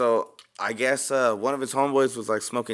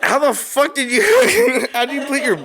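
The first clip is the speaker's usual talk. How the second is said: laughing; 11 semitones higher, 5 dB louder, slower than usual. Retold or imitated. Dad quoted while laughing.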